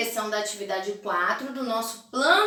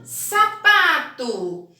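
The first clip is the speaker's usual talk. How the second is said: sapato drawn out very slowly and louder; very slow model word; 3 semitones higher, 5 dB louder, much slower than usual. Shown as a demonstration. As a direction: stretch sapato slowly for the class. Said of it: "E a última".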